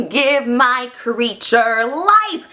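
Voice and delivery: hugely drawn-out, slow proclamation; dramatic scientist. Acted. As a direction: proclaim it like a mad inventor. Acting the doctor's musical climax.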